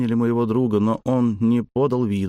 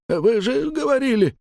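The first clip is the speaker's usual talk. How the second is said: much higher, cracking falsetto howl; broken falsetto wail. Acted. Frightened father howls at the master in broken falsetto. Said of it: "взвыл над потолком ломаным фальцетом".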